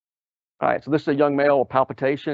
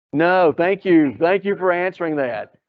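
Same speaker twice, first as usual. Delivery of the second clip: mock thanks; 5 semitones higher, 4 dB louder than usual. Sarcastic reply to wrong answer.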